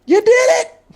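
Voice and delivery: cheering. Real, not acted. Cheering a teammate's success.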